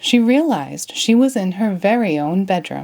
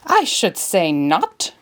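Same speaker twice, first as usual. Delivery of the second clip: slow, lofty dismissal; haughty queen; slower than usual. A narrator's reading. The Queen dismisses Alice, haughty. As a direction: look down on her. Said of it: "shrieked the Queen".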